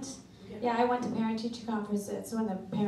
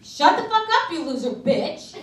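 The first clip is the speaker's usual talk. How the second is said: much higher, louder, slow yelling insult; retold yell; 7 semitones higher, 9 dB louder than usual. Mom yelling at her daughter in the waiting room. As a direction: scream it at the daughter. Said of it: "I started yelling".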